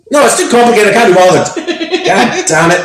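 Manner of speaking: high, loud, laughing complaint; laughing exasperation. Real, not acted. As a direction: give up noisily through laughter. Laughing, gives up on phone attempt.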